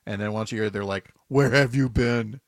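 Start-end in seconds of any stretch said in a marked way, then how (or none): 1.3-2.4 slow, put-out mock cow voice; lightly voiced waiting cow; imitated cows. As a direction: drag out the cows' complaint. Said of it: "what do the cows think about this".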